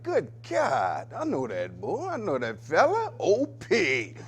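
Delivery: lightly acted surprise at a familiar voice; mock amazed recognition. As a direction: play the listener suddenly recognising someone. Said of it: "You be like".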